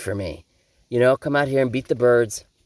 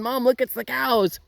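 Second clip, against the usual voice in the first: high, slow squeaky dog voice; excited dog voice; 10 semitones higher than usual. Pretend. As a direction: voice the jumping dog calling Mom. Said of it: "she's jumping".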